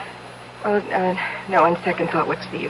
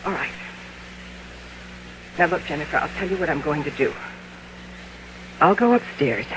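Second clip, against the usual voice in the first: low soothing calm; 5 semitones lower, 11 dB quieter than usual. Acted. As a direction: lower and softer, humouring her. Sister soothing in lower voice.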